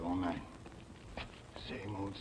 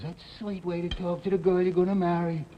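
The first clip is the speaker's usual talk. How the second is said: Cora's yell, much higher and louder; indignant fiancée's shout, higher and louder; retold yell; 8 semitones higher, 7 dB louder than usual. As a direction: fire it back at him as she did. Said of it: "And she yells".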